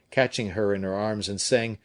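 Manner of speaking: ordinary delivery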